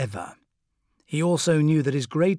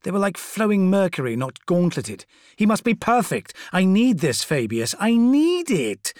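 desperate pleading; 7 semitones higher, 6 dB louder than usual. Acted. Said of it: "desperate demand to Fabius".

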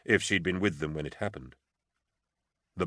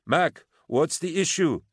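high, slow, carrying call; 10 semitones higher, 4 dB louder, slower than usual. A narrator's reading. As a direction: call the question up to someone far above. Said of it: "she called up".

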